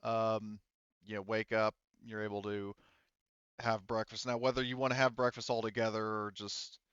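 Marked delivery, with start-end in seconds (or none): none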